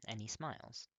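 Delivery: ordinary delivery, nothing marked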